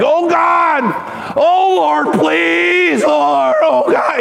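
hammed-up anguished altar plea; begging at the altar. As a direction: beg God desperately at the altar. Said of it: "we would beg".

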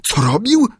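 high, keen retort; eager Friday. A narrator's reading. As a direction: toss it back high with zeal. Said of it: "odparł z zapałem". (z zapałem, wysokim głosem)

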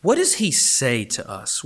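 normal talking voice

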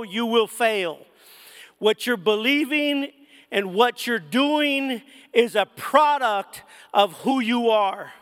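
usual speaking voice

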